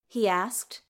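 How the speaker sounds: usual speaking voice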